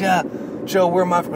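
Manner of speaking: high-pitched imitation of a keen challenger; eager player.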